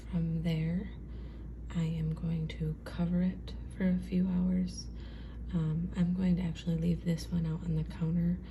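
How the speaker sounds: soft early-morning whisper. Real, not acted. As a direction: whisper so the house stays asleep. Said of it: "I get to be quiet 'cause my husband is sleeping".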